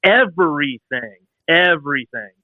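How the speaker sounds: "everything" stretched out slowly, each time; stress on everything. Retold or imitated. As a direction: draw out "everything" for exasperated emphasis. Listing all the lost bolts.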